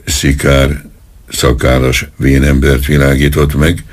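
ordinary delivery

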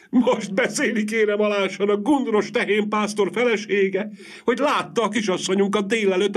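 acted shrieking grief, wringing every phrase; loud wailing. A narrator's reading. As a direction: wail like a distraught village woman. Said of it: "legjobban jajveszékelt Adamecné".

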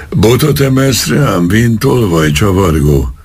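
put-on angry policeman snarls at the old man; raging. A narrator's reading. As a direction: foam at the mouth with rage. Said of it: "tajtékzott haragosan".

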